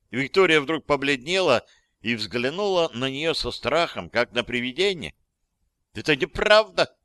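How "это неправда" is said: high, loud squeal of denial; loud, high-pitched yelp; frightened shriek. A narrator's reading. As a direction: shriek it in panicked protest. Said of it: "взвизгнула она".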